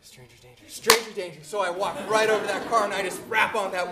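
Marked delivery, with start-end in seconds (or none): 0.0-0.6 breathless whisper of a warning to himself; a scared kid whispering to himself. Retold or imitated. 0.7-1.4 warning said aloud. Retold or imitated. Repeating warning, then out loud.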